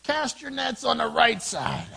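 high, drawn-out shout; yelling back. Acted. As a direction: call slowly across the water. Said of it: "the man yells back".

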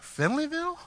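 high incredulous Finleyville; mock disbelief. Church friends laughing at the town, mocking disbelief. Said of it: "We were like".

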